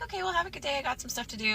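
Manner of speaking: high-pitched, soft casual goodbye; lightly imitated breezy phone goodbye; breezy sign-off. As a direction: act casual and end the call, starting high. Retold breezy sign-off to scammer.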